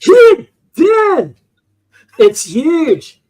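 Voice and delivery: high, loud, put-on voice; hammed-up movie quote. Host joining the movie quote in a loud put-on voice. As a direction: ham up the movie character's yell.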